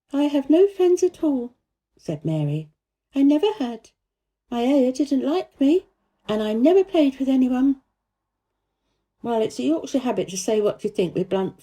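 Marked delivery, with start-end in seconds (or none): 0.1-7.8 put-on a lonely girl telling it straight; little girl Mary. A narrator's reading. Mary to the gardener, higher child voice. Mary's voice.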